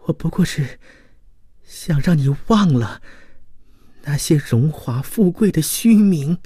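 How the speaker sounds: high, strained, choked-off woman's voice; thread-thin voice. A narrator's reading. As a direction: squeeze words out through a gripped throat. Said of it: "用一缕游丝般的声音说". (气若游丝地诉说)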